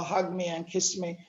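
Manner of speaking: ordinary talk, nothing marked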